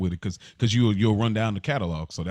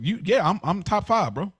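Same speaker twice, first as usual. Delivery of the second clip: demo cocky boast; 11 semitones higher, slower than usual. Shown as a demonstration. Host showing his guest how to boast, swaggering brag. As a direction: show him how to sell it, cocky. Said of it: "You gotta sell it".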